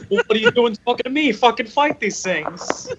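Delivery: high, fast mock scolding; mock game character scolding. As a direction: scold the player in a squeaky voice. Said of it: "It's like".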